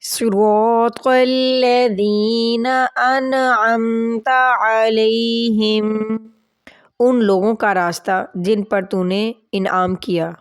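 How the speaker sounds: slow, drawn-out Arabic recitation. Shown as a demonstration. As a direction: recite each word slowly and clearly. Teacher recites prayer slowly.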